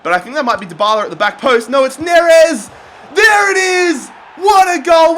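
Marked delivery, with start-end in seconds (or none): 1.7-5.2 full-throated goal celebration; long goal yell. Real, not acted. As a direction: shout it out, high and loud. Goal scored.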